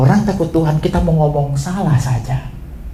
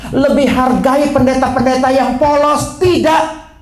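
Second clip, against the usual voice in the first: fervent preaching; 11 semitones higher than usual. Acted. Preaching at full force.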